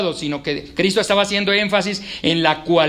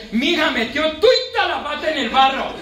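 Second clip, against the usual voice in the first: lightly voiced Boyacá mother, higher; a country mum scolding her daughter in a Boyacá accent; 8 semitones higher than usual. Mother Martica scolding her daughter, light Boyacá accent. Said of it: "Martica le dice a la hija".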